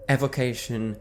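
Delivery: ordinary talk, nothing marked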